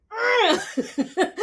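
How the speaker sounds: high laughing mock groan of disgust; exasperated groan. Retelling her anger to viewers, a high laughing groan. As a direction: groan it out, half laughing at yourself. Retold frustration after the phone call.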